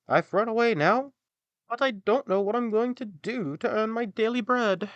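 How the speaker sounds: put-on tired old hound lamenting to the donkey; old dog. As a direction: whine it like a worn-out old dog. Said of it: "the dog replied".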